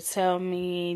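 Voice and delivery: usual speaking voice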